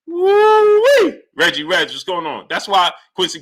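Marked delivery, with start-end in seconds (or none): 0.1-1.2 high, loud excited whoop; excited woo-wee. Real, not acted. Streamer whooping at his chat's boxing news. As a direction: let out a thrilled high whoop.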